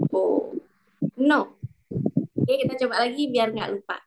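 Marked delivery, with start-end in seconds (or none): 1.1-1.5 No. dropping sharply; teacher's tone example; fourth tone demo. Shown as a demonstration. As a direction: snap No. down from high. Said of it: "ada empat kata yang bisa membantu".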